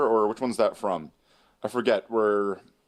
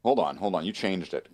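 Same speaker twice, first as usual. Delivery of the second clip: normal talking voice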